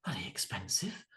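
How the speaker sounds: muttered under the breath; whispered aside. Retold or imitated. Dad at soft play griping about the price, whispered. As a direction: whisper it like a dad who shouldn't swear. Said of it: "the dads looking around going".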